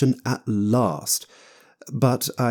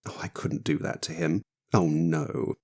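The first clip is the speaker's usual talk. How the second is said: mock pity; 4 semitones lower, 6 dB quieter than usual. A narrator's reading. Fate feigning pity.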